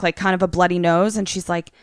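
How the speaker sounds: ordinary delivery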